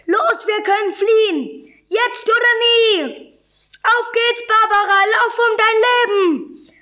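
very high, excited shouting; urgent escape cries. A narrator's reading. Caged animals seeing a chance to flee, urgent high shouts.

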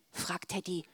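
ordinary delivery

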